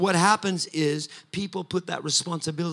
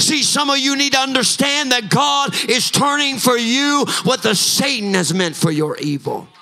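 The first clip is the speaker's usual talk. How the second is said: louder and higher, chanting, building preaching; preaching at full force; 5 semitones higher, 8 dB louder than usual. Acted. Pastor declaring victory over the enemy to the church, full-force preaching. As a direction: drive each "coming out" line harder, near song.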